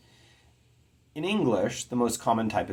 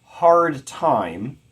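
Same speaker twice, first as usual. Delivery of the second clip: Hard time punched louder and higher on both words; both words stressed; 5 semitones higher, 9 dB louder than usual. Shown as a demonstration. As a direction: give HARD and TIME equal heavy weight. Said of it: "they're both stressed".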